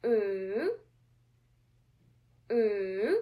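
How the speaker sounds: "Mm" in a long swoosh, down then up; big third tone. Shown as a demonstration. Teacher drawing a big Nike with sound, long dipping hum. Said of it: "Let us practice it".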